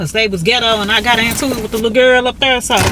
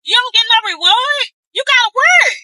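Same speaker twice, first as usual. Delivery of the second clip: preaching; 12 semitones higher than usual. Acted. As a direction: hammer it home like the pulpit. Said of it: "before I start preaching".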